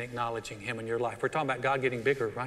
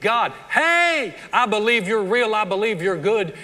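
higher, louder glad praise; joyful worship; 5 semitones higher, 7 dB louder than usual. Shown as a demonstration. Worshipper greeting God warmly in church, joyful and loud. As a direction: greet God like a dear friend. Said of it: "what you're saying is".

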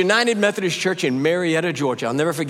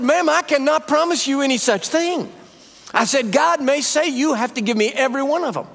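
high, insistent retold warning; emphatic warning; 8 semitones higher than usual. Preacher retelling his answer to the worried woman, emphatic. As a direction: raise the pitch and insist firmly.

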